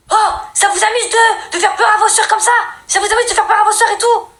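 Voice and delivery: hammed-up dad scolding the boys; scolding father. Father scolding the brothers, high mock-angry voice.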